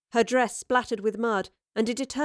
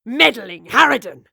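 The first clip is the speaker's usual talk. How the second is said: a loud furious scream; a gunman screams at the professor; 11 dB louder than usual. Acted. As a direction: scream the insult at her. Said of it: "he screamed".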